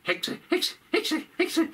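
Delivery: high yapping dog voice calling out. Acted. Dog Fido calling out to his owner. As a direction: yap the warning like a little dog. Dog barks warning about witch.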